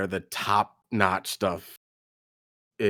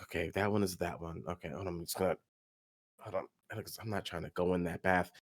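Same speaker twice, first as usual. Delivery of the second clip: soft, quick, low mutter; quiet, hurried self-talk; muttering to oneself; 5 semitones lower, 13 dB quieter, much faster than usual. Acted. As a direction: talk yourself through it under your breath. Character muttering to himself at work.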